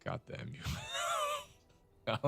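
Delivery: drawn-out high wail of pain at the joke; anguished groan. Real, not acted. Cohost groaning at a terrible pun. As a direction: groan like the pun physically hurts. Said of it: "I'm out".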